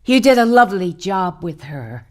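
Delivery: ordinary talk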